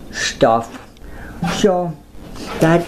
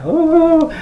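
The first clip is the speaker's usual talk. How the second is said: high ooh; 13 semitones higher than usual. Real, not acted. Squeal reacting to own joke.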